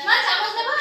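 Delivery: calling out. Real, not acted. Calling out to her mother.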